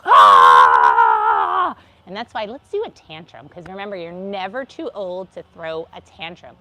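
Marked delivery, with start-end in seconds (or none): 0.0-1.8 a loud, low, raw yell; amygdala scream. Shown as a demonstration. Teacher showing an emotional hijack, loud yell. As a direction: let out a full-throated shout. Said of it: "where it could just go".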